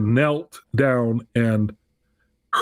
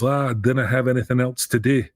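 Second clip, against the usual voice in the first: imitated Scottish accent; Scots accent. Imitating a Scottish churchgoer answering the missionary couple, Scots accent. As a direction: put on a broad Scots accent for the quote. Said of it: "I do a pretty good Scots accent".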